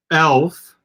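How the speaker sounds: normal talking voice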